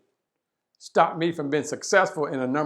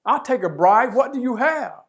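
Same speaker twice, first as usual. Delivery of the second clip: higher, eager, mock-greedy guard grabbing the bribe; 8 semitones higher than usual. Speaker voicing the bribed guard to the seeker, higher and eager. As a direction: play the guard keen on a bribe. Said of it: "The voice says".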